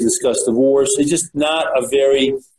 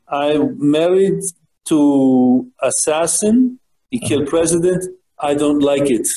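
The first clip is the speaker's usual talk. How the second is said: put-on Russian accent. Retelling Marina Oswald's words in a mild Russian accent. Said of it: "in her Russian accent".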